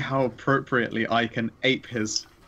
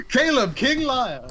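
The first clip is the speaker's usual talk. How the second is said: high, drawn-out triumphant proclamation; mock fanfare; 12 semitones higher, 4 dB louder, slower than usual. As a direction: herald a champion with mock grandeur.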